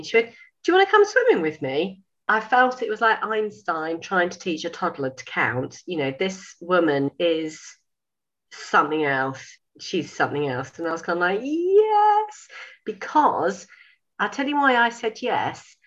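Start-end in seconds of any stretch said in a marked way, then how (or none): 0.3-2.0 high, loud bright offer; a friendly high invitation; lightly voiced cheerful invite. Louise inviting her to swim, lightly voiced high and cheerful. 11.4-12.5 high, loud held yes; eager yes. Retold or imitated. Agreeing to go swimming with Louise, a long high yes.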